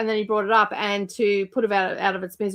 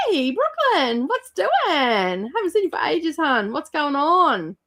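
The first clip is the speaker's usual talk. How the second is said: high, drawn-out greeting voice; sing-song welcome; 7 semitones higher, slower than usual. Pretend. Welcoming a returning viewer in high sing-song. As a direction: call out a happy hello.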